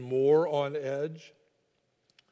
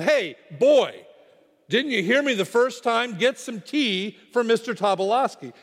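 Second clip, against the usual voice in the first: higher, louder impatient order; staffer's yell; 6 semitones higher, 4 dB louder than usual. Retold or imitated. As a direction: yell a scolding demand at an underling. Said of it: "The staffer yelled".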